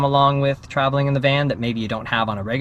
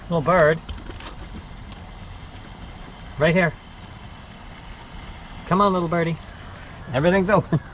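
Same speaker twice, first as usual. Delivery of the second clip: gentle coaxing, a bit higher; coaxing a wild bird; 4 semitones higher than usual. Real, not acted. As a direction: sweet-talk the bird toward the window.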